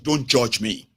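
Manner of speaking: ordinary talk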